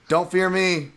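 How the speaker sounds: half-hearted tough-guy promo line; Paul Buentello promo. Retold or imitated. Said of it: "trying to cut like an old school W W F promo there".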